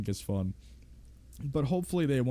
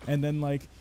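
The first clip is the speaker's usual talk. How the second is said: ordinary talk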